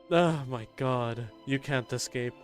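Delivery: ordinary delivery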